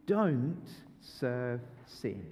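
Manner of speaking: slow and low, leading the room; each word laid down slowly; slow group recital. Shown as a demonstration. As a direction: spell it out slowly for the kids. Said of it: "can you do it with me?".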